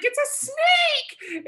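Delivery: very high, stretched-out panicked squeal; mock girlish scream. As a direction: imitate him shrieking in terror like a little girl. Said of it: "he screams like a girl".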